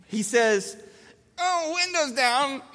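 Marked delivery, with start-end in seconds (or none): 1.4-2.6 put-on high, slow, whiny child voice; tantrum toddler. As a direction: imitate a small child wailing a demand. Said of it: "having a tantrum".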